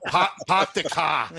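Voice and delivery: slow mock Boston "pahk the cah"; Boston accent. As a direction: imitate a Bostonian, dropping the r's. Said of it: "Karen's got a Boston accent".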